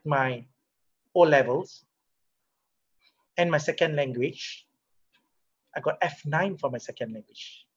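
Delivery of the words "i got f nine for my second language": usual speaking voice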